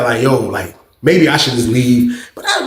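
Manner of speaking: ordinary delivery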